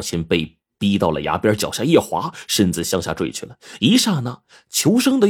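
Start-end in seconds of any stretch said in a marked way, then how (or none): none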